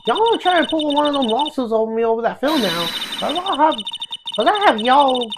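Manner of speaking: combative Southern twang; Southern accent. Acted. Southern woman facing down a lawyer, combative Southern accent. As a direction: dare him to sue, in a Southern accent. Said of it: "It's kinda hard talking to Southern accent".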